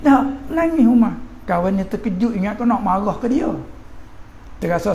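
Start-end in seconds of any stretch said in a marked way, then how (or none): 0.1-1.2 animated exclamation. A narrator's reading. Teacher dramatizes Umar weeping.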